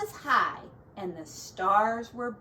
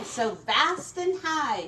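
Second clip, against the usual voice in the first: plain reading voice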